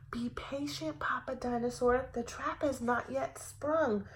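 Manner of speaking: whispered, conspiratorial dinosaur; hushed warning. A narrator's reading. Dinosaur hushing Papa Dinosaur while waiting in ambush, whispered. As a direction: hiss it so the girl won't hear.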